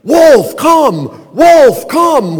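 loud high shout across a field; calling out. Retold or imitated. Owner shouting after her runaway dog, loud and high. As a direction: yell for the dog to come back. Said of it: "and I'm calling".

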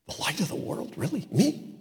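whispered, rising in disbelief; incredulous whisper. Acted. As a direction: hush it as if you can't believe it. Incredulous reaction to Jesus.

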